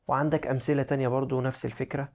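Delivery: ordinary talk, nothing marked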